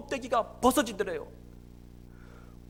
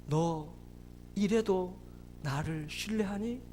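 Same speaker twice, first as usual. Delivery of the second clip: low, slow, hushed divine voice; God's gentle question; 5 semitones lower, 8 dB quieter, slower than usual. Retold or imitated. God asking a suffering father, low solemn question. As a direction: ask softly and low, with long pauses. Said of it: "하나님 묻더래요".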